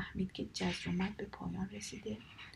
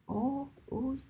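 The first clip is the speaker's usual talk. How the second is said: higher, louder pained gasp; 6 semitones higher, 5 dB louder, slower than usual. Acted. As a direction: wince at the sharp pull. Thorn pulled from foot.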